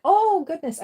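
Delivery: loud, high startled exclamation; surprised. Real, not acted. Surprised by cat.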